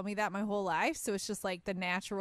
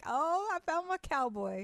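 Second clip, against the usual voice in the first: put-on swooning girl; 6 semitones higher than usual. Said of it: "I'm like".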